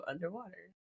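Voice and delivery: usual speaking voice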